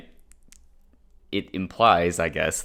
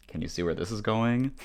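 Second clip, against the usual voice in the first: ordinary talk, nothing marked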